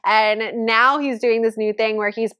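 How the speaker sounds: usual speaking voice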